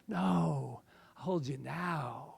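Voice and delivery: ordinary delivery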